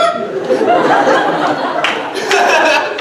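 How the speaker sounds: loud, high burst of laughter; long breathless laugh. Real, not acted. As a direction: crack up at the absurdity. Laughs at the reveal.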